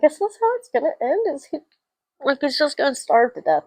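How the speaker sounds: high-pitched retold dismay; worried disbelief. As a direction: ask it in high, anxious disbelief. Retells worried reaction to ending.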